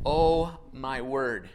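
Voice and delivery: slow, low, drawn-out dismay; aghast. Acted. Visitor seeing his messy house this morning, slow and aghast. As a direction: play a visitor taking in a disaster, slowly.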